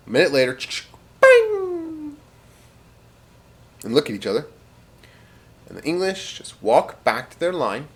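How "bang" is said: high ringing vocal bang; imitated gunshot ring. Storyteller imitating a shot fired skyward, high ringing bang. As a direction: make a loud, very high ricochet noise.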